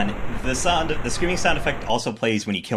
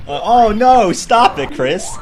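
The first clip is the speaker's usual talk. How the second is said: mock protest; 8 semitones higher, 6 dB louder, slower than usual. Real, not acted.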